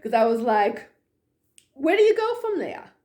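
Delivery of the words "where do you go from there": exasperated. Retold or imitated. Exasperated after being doubted.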